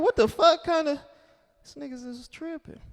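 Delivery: high, disbelieving outburst; shocked. Retold or imitated. Comedian reacting to polite crack dealers, shocked. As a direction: blurt it out in shock.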